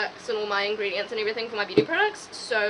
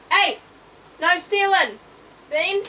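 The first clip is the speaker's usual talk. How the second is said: loud, higher telling-off to the pets; scolding the pets; 7 semitones higher, 6 dB louder, slower than usual. Real, not acted. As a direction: snap at the pets to stop stealing. Scolding pets over food.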